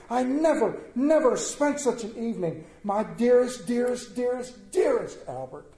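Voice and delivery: overflowing with lightly mocked bliss; gushing diary reading. Queen gushing over Albert in her diary: high, elated. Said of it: "She wrote in her diary".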